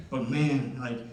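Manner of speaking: ordinary talk, nothing marked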